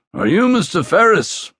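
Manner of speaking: put-on a gruff Belfast accent from a big detective. Detective waking a soldier in the mess, Belfast accent. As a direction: rasp it out in a Northern Irish accent. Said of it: "The scrape of the Belfast accent".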